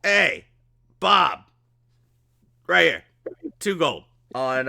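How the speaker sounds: high, loud goblin shout across to a colleague; calling out. Acted. Goblin bookie to the other goblin, calling out. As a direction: call it out in short, loud bursts. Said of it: "looks over at, uh, the other goblin and goes".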